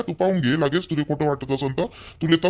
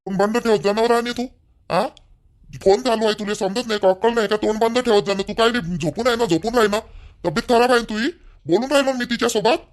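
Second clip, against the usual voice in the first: raised, higher-pitched telling-off; angry scolding; 6 semitones higher than usual. Acted. Husband silencing his wife during a phone call, angry scolding. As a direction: snap at her to shut up. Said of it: "ओरडू नका [name] मायावर".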